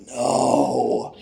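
low, drawn-out groaning no; realizing he missed it; dismayed no. Retold or imitated. Hears the roar after leaving.

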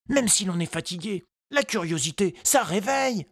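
put-on high, light boy's voice; child voice. A narrator's reading. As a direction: voice a hopeful little boy. Tistou's thoughts voiced.

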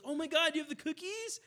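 hammed-up delight at the cookies; mock excitement. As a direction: imitate a customer who is thrilled every single day. Mimicking excited repeat customer.

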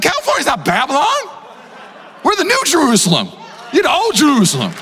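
laughing comeback. Retold or imitated. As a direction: fire back a playful rebuttal. Retorting to a friend's text.

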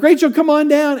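high, loud call up the hill; coaxing shout to a scared child. Retold or imitated. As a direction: call her down from the top of the hill. Calling daughter stuck atop hill.

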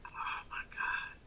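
slow, hushed disbelief; appalled whisper. Real, not acted. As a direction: whisper it slowly, grossed out. Appalled reaction to crude remark.

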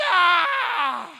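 sudden loud exasperated "Ah!"; exasperated shout. Retold or imitated. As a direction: blurt it out like a fed-up parent in the pew. Parent sees kids misbehaving in church.

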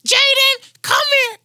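very high, loud holler; imitated parent calling. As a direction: holler for a misbehaving kid across the store. Parent calls the boy over.

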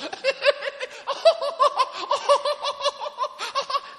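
high, loud burst of laughter; long, high-pitched laughing fit, imitated. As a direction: act out someone helpless with laughter. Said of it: "the person begins to laugh".

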